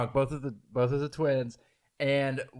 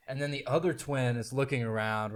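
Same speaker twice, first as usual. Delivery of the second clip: ordinary talk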